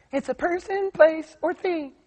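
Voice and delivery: high put-on student voice; confident schoolgirl. Young lady answering the teacher's question, high and confident. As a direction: pitch it way up, drawing out the answer.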